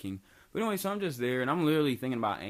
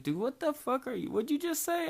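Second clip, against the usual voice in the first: shocked friend; 14 semitones higher than usual. Retold or imitated. Friend reacting in disbelief, retold.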